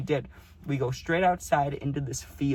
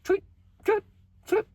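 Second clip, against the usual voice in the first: very high, short bird tweets; bird chirping; 4 dB louder than usual. Retold or imitated. Imitating a bird on a branch, high chirps. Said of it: "like bird noises".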